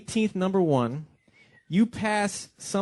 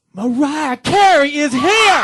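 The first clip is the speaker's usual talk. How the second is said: slow, drawn-out hype shout; hype announcement; 11 semitones higher, 11 dB louder, much slower than usual. Real, not acted. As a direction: announce her like a headliner. DJ announcing the star guest.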